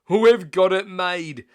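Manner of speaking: high, loud, slow gloat; gloating thieves. Acted. Thieves to each other, loud slow gloat. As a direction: crow over the win.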